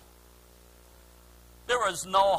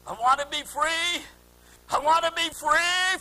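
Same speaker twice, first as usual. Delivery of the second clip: raised, building plea; high imploring cry, growing louder; 9 semitones higher, 5 dB louder than usual. Retold or imitated. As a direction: cry out from the depths of the heart. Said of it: "they're crying out".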